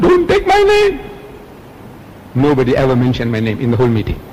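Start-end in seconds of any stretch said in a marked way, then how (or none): 0.0-1.0 high pleading quote. Retold or imitated. Retelling his plea at meeting.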